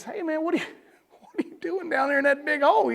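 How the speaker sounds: retold curious bystander; lightly voiced passerby. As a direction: lightly act out a fella calling down a hole. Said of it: "and he says".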